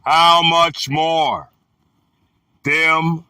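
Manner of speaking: slow, halting phrases with weighty pauses; scripture reading. Acted. Reader giving listeners the words of the Son, solemn scripture reading. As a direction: read it out deliberately, a few words at a time.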